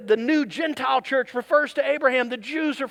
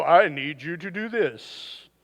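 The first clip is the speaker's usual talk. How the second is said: low, slow hammed-up vampire; 6 semitones lower, slower than usual. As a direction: drag it out like a horror-movie vampire. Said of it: "I gave him a vampire voice".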